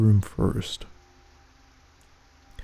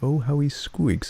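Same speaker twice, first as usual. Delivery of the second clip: cruel sister; 5 semitones higher than usual. Acted. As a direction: savour the cruelty. Sister gloats about hurting rat.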